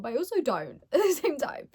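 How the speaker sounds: ordinary talk, nothing marked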